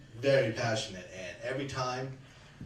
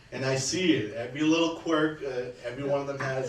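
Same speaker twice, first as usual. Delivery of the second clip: ordinary talk, nothing marked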